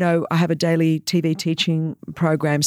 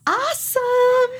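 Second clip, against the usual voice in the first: "Awesome" as a long, high, open "AH-some" in mock American; mock American accent; much slower than usual. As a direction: exaggerate the American "Awesome", drawn out and much higher. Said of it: "Not like".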